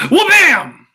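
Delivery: high, loud burst of amazement; excited exclamation. Retold or imitated. Fan reacting to the new Superman design, loud and high. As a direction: blurt it out like a comic sound effect.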